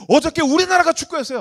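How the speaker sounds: high, loud indignant protest; acting out an outraged soccer fan; indignant young churchgoer. Indignant youth insisting to pastor.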